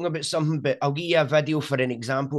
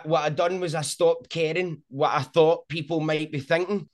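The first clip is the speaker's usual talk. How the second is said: usual speaking voice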